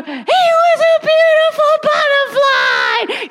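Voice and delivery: very high, slow, drawn-out squealed reading; over-the-top excited story climax. Retold or imitated. Mother reads the last page to her baby, hammed-up excitement. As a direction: belt out the big reveal, hammed-up.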